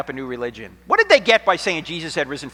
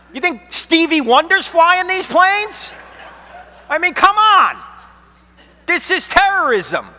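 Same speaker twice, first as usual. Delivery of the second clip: indignant rant; 10 semitones higher, 5 dB louder than usual. Retold or imitated. Mocking the CNN commentator.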